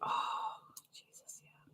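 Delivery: breathless whispered wince; pained whisper. Real, not acted. Wincing at her sore foot, pained whisper. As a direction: whisper it through the pain.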